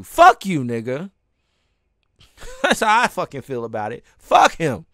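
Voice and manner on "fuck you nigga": angry retort. Real, not acted. Angry at the threatener.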